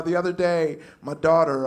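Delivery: ordinary talk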